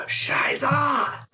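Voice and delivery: high-pitched drawn-out German curse; bellow. Acted. Player's character falls from the banister, high comic bellow. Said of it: "And I just bellow".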